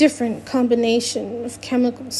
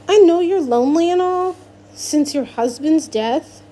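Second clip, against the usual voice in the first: louder, higher, biting; spitting anger; 5 semitones higher, 4 dB louder than usual. Acted. Alex spits an accusation at Miss Teak, higher and louder. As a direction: spit the words at her. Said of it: "I spit at her".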